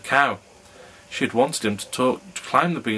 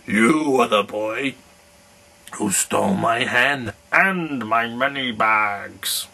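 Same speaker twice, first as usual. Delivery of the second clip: slow, louder accusing giant voice; bellowing giant; 4 dB louder, slower than usual. Acted. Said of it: "he bellowed".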